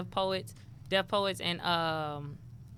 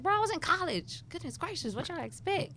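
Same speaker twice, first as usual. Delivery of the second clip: high, softer incredulous complaint; exasperated protest; 10 semitones higher, 4 dB quieter than usual. Retold or imitated. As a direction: plead your case to the city. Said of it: "And I was just like".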